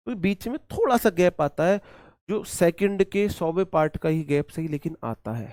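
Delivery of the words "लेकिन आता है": ordinary talk, nothing marked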